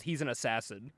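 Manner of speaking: low, quiet, matter-of-fact reveal; hushed dry statement; blunt deadpan. Retold or imitated. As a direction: drop it softly and let it land.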